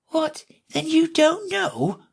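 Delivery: high tiny insect voice; a very small voice right at her ear. A narrator's reading. Tiny gnat voice teasing Alice at her ear. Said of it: "the little voice began".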